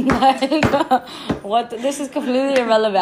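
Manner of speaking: loud, high, giggly outburst; amused and baffled at yourself; laughing disbelief. Real, not acted. Cracks up at own remark.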